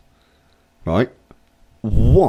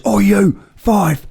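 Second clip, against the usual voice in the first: barking ref; 9 semitones higher, 3 dB louder, slower than usual. Retold or imitated. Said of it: "he goes".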